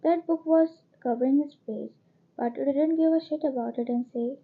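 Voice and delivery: ordinary delivery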